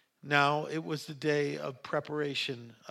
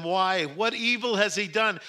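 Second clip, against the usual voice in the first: high, loud challenging question; raised protest; 6 semitones higher, 5 dB louder than usual. A narrator's reading. Pilate challenges the crowd's demand, raised protest.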